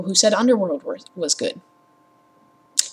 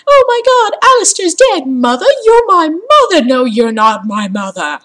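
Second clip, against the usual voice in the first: very high, loud melodramatic wail; mocking Lara; 8 semitones higher, 7 dB louder than usual. Gamer mocking Lara's overdramatic grief, high. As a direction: ham up the grief.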